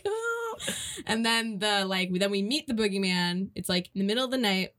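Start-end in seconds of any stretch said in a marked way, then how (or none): 0.0-0.7 much higher, softer, crying little question; mock whimper. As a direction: whimper it like a scared kid. Mock frightened viewer.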